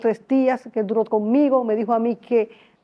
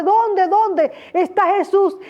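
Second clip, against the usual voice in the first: eager asking; 8 semitones higher, slower than usual. Retold or imitated. Husband asking excitedly.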